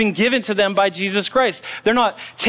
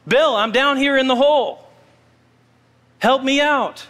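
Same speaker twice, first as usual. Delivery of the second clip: calling for help; 6 semitones higher than usual. Acted. Jim calls to his passing friend, mock cry for help.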